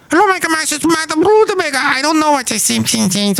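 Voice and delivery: high squeaky cartoon visitor; cartoon character voice. Cartoon visitor greeting Mike, high squeaky voice.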